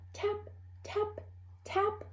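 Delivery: high, soft unvoiced tapping; tiny taps. A narrator's reading. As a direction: tap it out lightly. Tiny tapping under the nest.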